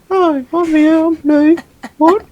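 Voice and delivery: very high, hammed-up British woman's voice; badly faked British falsetto; bad female British accent. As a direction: pitch it way up for a mock British lady. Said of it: "trying to do a female voice and a British accent".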